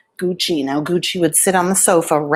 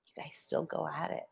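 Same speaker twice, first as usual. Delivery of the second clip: whisper; 20 dB quieter than usual. Real, not acted. Whispering beside the sleeping lizard.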